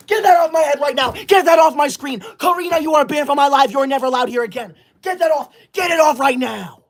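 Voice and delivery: high, fast, shouted banishment; a high, rapid, furious tirade; angry shouting. Acted. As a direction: yell the gift-sender out of the live. Furious ban of the gift-sender.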